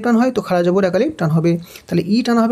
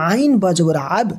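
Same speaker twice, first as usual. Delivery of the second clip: ordinary talk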